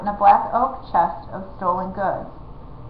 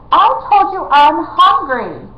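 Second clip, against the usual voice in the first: high, loud, slow whine; a spoiled royal sulking; whiny prince; 8 semitones higher, 8 dB louder, slower than usual. Acted.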